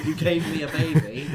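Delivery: high, loud laughing protest; mock indignant student.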